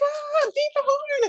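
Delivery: put-on high, slow excited child squeal; mock kids begging the driver to honk; kids shouting excitedly. Kids excited at garbage truck.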